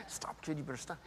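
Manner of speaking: hushed through a fake smile; mock whispered warning. Dad warning his daughter quietly in a crowded show, whispered. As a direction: hiss it under your breath, smiling.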